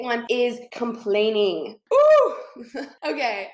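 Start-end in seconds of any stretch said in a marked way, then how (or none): 1.9-2.3 sudden high, loud ooh; excited ooh. Real, not acted. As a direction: squeal it out like a guilty thrill. Reacts to naming complaining.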